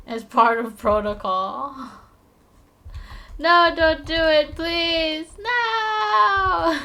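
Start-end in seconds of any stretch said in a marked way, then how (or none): 3.4-6.8 mock desperate plea. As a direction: plead as if truly doomed, all for show. Begs not to be hung up.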